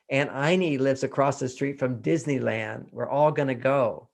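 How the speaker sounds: ordinary delivery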